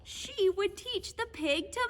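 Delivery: ordinary delivery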